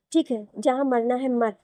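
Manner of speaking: ordinary delivery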